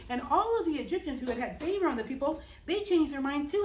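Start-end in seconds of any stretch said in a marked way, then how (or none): none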